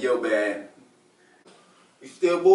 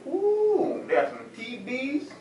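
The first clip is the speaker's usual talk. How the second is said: delighted ooh; 6 semitones higher, 6 dB quieter, slower than usual. Acted. Burglar gasps at loot.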